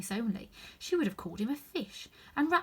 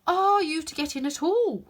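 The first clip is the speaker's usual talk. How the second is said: footman voice; 8 semitones higher, 9 dB louder than usual. A narrator's reading. Footman retorts to Alice.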